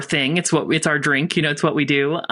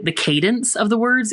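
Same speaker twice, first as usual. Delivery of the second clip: ordinary talk, nothing marked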